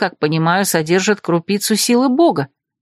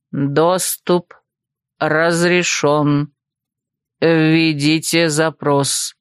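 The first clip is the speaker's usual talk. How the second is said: slow, low robotic monotone; lower, drawn-out machine voice; mechanical voice; 4 semitones lower, much slower than usual. A narrator's reading. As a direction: speak like an automated system, word by word. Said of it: "странный механический голос".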